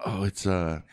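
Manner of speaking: normal talking voice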